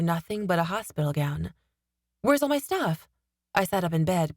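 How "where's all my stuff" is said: high, urgent panicked question; sudden alarm, voice jumps up. A narrator's reading.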